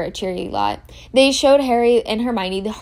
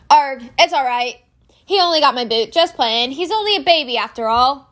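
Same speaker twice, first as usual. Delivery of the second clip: higher, louder gruff-hearted giant; bitten Hagrid; 4 semitones higher, 5 dB louder than usual. Acted. Hagrid brushing off the dragon bite to the children, higher and louder. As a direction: shrug off the bite fondly.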